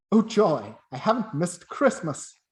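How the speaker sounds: loud, rising with delight; joyful. Acted. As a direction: Scrooge bursting with joy. Scrooge rejoicing.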